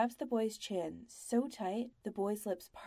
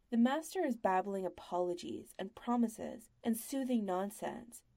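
ordinary delivery, nothing marked

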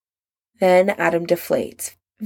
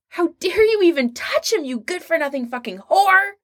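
much higher, raging insult; furious outburst; 10 semitones higher than usual. A narrator's reading. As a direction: spit the insult out in pure hatred. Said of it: "He's never seen Adam so angry".

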